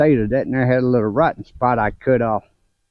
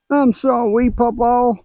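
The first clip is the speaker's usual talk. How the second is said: put-on high, whimpering little-boy apology, much higher; imitating a crying grandchild; 11 semitones higher than usual. Grandpa imitating crying grandson's apology, high whimper. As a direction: mimic a tearful five-year-old saying sorry. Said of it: "He started crying".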